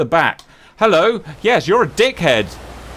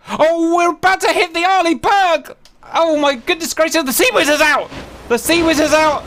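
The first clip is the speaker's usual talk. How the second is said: high, thrilled cheering; real delight as the hits land; excited; 11 semitones higher than usual. Real, not acted. Gamer cheering his fleet on, high and excited. Watching enemy ship get hit.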